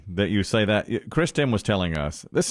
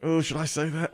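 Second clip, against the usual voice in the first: softer, second-guessing aside; hesitant aside; 3 semitones higher, 5 dB quieter than usual. Retold or imitated. Speaker doubting the word Mexican, hesitant aside. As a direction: drop the volume as if unsure. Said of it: "when I say it, I'm like".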